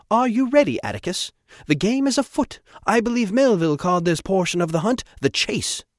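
high, loud, theatrical commander; eager, imperious Trevor. Acted. Trevor rallies Atticus for the chase, high and eager. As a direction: relish the hunt in a high voice.

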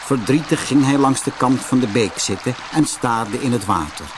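plain reading voice

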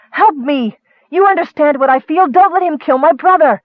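high, loud, frantic begging; panicked cry for help; desperate plea. A narrator's reading. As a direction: plead for your brother's life. Sister begs help to save brother.

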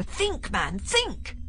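sharp, high irritated snap; snapped. A narrator's reading. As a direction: snap it out impatiently. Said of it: "snapped the doctor".

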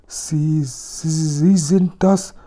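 high, slow, stammering in fright; frightened stammer. Acted. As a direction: stumble over the words, scared stiff. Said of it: "stotterte Kasperl". (hoch und langsam, ängstlich stotternd)

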